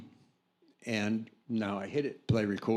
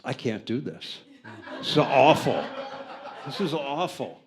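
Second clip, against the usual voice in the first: laughing retell; 3 semitones higher, 5 dB louder than usual. Retold or imitated. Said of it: "Jack Adams turns to me and he says".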